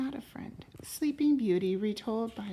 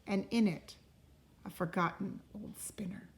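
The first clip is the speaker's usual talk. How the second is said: unhurried read-aloud voice, rising on the opening lines; storybook narration; 7 dB louder than usual. A narrator's reading. Parent reading a fairy tale to a child, gentle storytelling. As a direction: tell it like a bedtime story to a child. Reads a picture book aloud.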